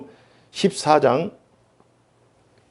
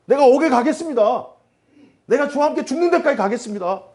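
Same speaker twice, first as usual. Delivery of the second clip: high, boasting Peter vow; boastful declaration; 11 semitones higher than usual. Retold or imitated. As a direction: puff up and proclaim it much higher. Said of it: "막 큰 소리를 치면서 얘기하는 거예요". (높은 목소리로 큰소리치는 베드로)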